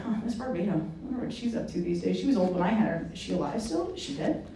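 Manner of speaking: musing to self. Retold or imitated. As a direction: think aloud quietly. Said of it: "you're like".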